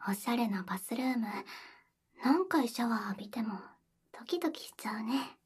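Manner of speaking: whispering. Acted. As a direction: breathe it close to the ear. Intimate whispered roleplay. (耳元でささやく)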